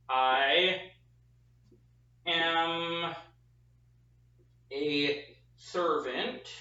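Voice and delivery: "I am a servant and you are a disciple" with long pauses between words; slow deliberate dictation. Shown as a demonstration. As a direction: spell it out one word at a time. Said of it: "it says".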